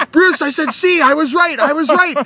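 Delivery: high, loud triumphant yell; excited yell at Bruce from the car. Retold or imitated. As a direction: shout it like you just won. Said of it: "I'm yelling in the car".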